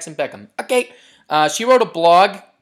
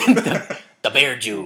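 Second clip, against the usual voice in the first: mock menace; 4 semitones lower than usual.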